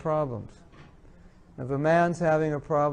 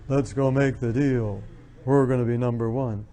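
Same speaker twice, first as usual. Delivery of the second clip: lowered businesslike voice; 4 semitones lower than usual. Shown as a demonstration. Woman seeking respect at work, lowering her voice. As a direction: drop the voice to sound businesslike. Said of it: "more respected if they lower their voice".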